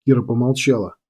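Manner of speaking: ordinary delivery, nothing marked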